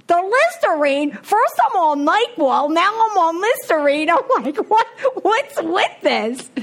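high, loud exasperated disbelief; incredulous disbelief. Retold or imitated. Wife incredulous at husband telling her to drink Listerine, high and loud. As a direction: react like it's absurd.

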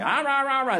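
high "rah rah" nagging noise; mock scolding gibberish. Sponsor chewing him out by phone, mock scolding gibberish. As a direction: imitate an angry caller as pure noise. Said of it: "my sponsor calls me".

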